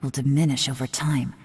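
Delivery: ordinary delivery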